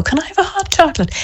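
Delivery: put-on high, fast, eager child voice; bouncing kid butting in for hot chocolate. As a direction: chirp it out like a keen little boy. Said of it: "And he'll go".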